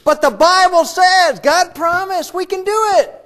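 loud, high, slowed proclamation; proclaiming loudly. Pretend. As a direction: belt it out like a triumphant believer. Claiming scripture with great comfort.

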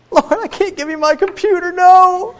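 very high-pitched mock protest to the Lord; mock pleading. Preacher playfully begging the Lord to spare his computer, mock pleading.

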